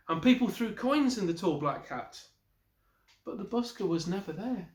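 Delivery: ordinary delivery, nothing marked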